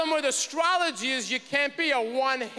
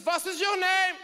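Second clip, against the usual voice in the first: put-on higher, clipped German-accented demand; German stewardess question; 6 semitones higher than usual. Stewardess demanding his name in a German accent. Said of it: "She says".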